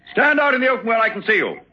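high, rapid order into the dark; commanding call. Acted. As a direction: demand that he show himself. Orders hidden intruder to show himself.

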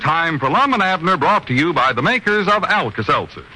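bright, formal announcer delivery; old radio announcer. Acted. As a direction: present the show like a 1940s announcer. Radio show opening.

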